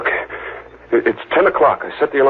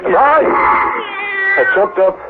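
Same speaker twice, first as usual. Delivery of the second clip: startled panic; much slower than usual. Acted. Waking in terror.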